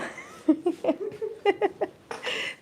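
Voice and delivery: squealing high laugh. Real, not acted. Laughing at mom.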